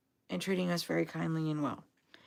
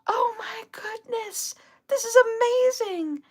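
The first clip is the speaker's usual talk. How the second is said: hushed, much higher gasp of wonder, then louder; breathless awe, pitched way up; amazed gasp; 4 dB louder, slower than usual. Retold or imitated. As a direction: imitate a stunned stranger seeing the duck. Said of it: "And she's like".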